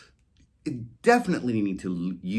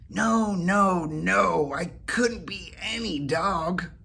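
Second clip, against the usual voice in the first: higher cat voice, slowing at the end; cat refusing; 9 semitones higher, slower than usual. Acted. Whiskers refusing Molly's idea, higher cat voice. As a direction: shake off the idea.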